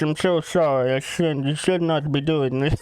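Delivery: put-on slurred drunk mumbling sorry; mock drunken pleading; imitating drunk apology. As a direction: play a wasted man slumped on the bed. Slumped on bed, drunk apology.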